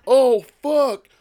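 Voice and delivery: high, loud, gleeful outburst; laughing excitement. Retold or imitated. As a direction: cry it out laughing at the blood. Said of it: "And I was like".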